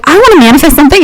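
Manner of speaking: high-pitched imitation of an eager kid; hammed-up childish eagerness; eager twelve-year-old. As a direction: play your younger self, wishing hard. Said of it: "I'm, like, twelve being like".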